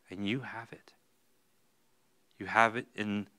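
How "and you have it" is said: soft confiding whisper. Real, not acted. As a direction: lean in and whisper it to them. Intimate reassurance to congregation.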